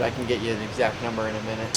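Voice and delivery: ordinary talk, nothing marked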